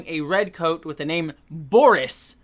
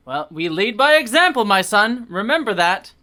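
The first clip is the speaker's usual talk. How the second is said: louder, higher preachy admonishment; grandmotherly scold; 5 semitones higher, 7 dB louder than usual. Acted. Vicar scolding Maxim like a grandmother. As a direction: lecture him like a pious grandmother. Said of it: "Sounds like my grandmother".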